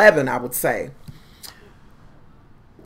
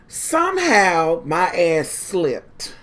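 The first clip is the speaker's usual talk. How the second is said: slow dramatic telling; 4 dB quieter, much slower than usual. Acted. Dramatic pause in fall story.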